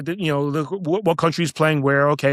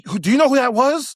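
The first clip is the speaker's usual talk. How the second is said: incredulous question, pitched high; urgent disbelief; 9 semitones higher than usual. Retold or imitated. Man running over to the bouncer who turned someone away, incredulous. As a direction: imitate an astonished onlooker, voice jumping up.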